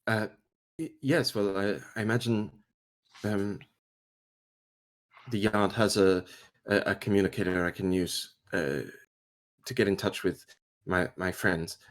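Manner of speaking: soft, halting, stammered request; timid hesitation. Acted. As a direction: ask meekly, pausing between fragments. Junior timidly asks for communicator.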